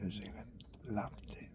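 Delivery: whispered fondness. Real, not acted. Fond memory.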